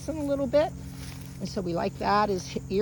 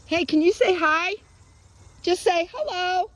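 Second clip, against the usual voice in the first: higher, sing-out coaxing voice; dog-coaxing; 7 semitones higher than usual. Real, not acted. Trainer asking dog to greet stranger, coaxing. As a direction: coax with a rising, high lilt.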